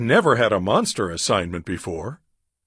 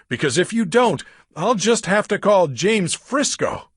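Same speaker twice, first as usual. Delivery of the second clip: high, fast, frightened urging; frantic plea; 8 semitones higher than usual. Acted. Gabby begging Drake to come over, high and frantic. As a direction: beg him to come right now. Frightened girl begs on phone.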